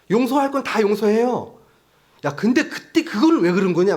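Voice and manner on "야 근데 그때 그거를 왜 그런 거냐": higher, louder demanding why; reproachful interrogation. Retold or imitated. Friend grilling a forgiven person about the past, loud and reproachful. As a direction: needle someone over an old wrong, loudly. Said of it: "막 이렇게 물어보잖아요".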